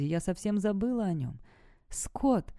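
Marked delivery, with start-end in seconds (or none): 1.9-2.5 raised-pitch call of a name; mildly cried-out name. A narrator's reading. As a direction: call out to him, without much volume. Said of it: "выкрикнула я".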